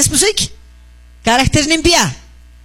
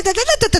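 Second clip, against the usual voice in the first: mock back-talk; 10 semitones higher, faster than usual. Mimicking talking back.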